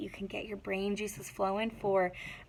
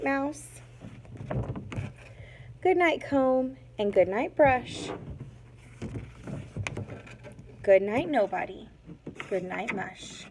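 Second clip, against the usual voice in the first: put-on reading to children. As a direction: read it gently like a bedtime book. Teacher reads picture book aloud.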